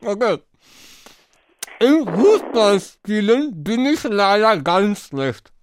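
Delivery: talking round a mouthful of marshmallows; mouth full. Real, not acted. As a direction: cheeks stuffed, words mushy. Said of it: "Ich musste sie jetzt ausspucken".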